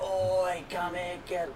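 high, hushed mock holler; retold hailing call. Retelling hailing a passing boat.

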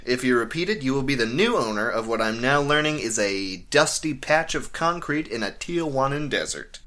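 ordinary delivery

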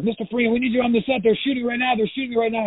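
high-pitched urgent call; urgent summons. Retold or imitated. Crew member pounding on the star's door, high urgent summons. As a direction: hurry someone out in a high, pressing voice.

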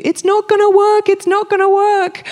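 high, mocking voice of the doubters. Preacher mocking the crowd saying it won't work, high taunting voice. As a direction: mimic the naysayers high and taunting.

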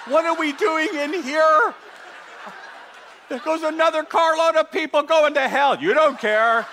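hammed-up evangelist, fed up with sitting still; impatient evangelist. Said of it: "they're like".

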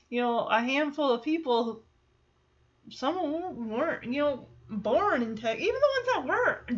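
ordinary talk

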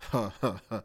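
low, soft run of chuckles; quiet drawn-out laugh; amused chuckle. Real, not acted. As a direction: chuckle to yourself at the thought. Laughs at the thought of Rams.

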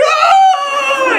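high, loud fangirl shriek; imitated excited scream. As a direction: squeal it out, mimicking a starstruck mom. Imitating mom's scream on meeting pope.

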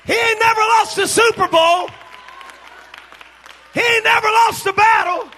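high, shouted sermon climax; roaring, exultant preacher's delivery; full-force preaching. Real, not acted. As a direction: shout praise to rouse the congregation.

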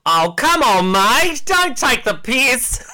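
a high, loud, hammed-up Australian accent with a laugh; mock Australian accent. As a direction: play the cheeky Aussie mate, laughing through it. [name] put on the spot, Australians.

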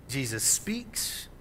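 slow, soft and low; reassuring. Acted. Preacher voices Jesus speaking to the disciples, slow and soft. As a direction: let the words settle calmly. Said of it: "the voice is reassuring".

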